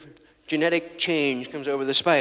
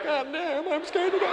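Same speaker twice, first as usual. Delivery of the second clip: much higher shouted panic; mock panicked sidekick; 13 semitones higher, slower than usual. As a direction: squeal out your terror. Scared sidekick in movie.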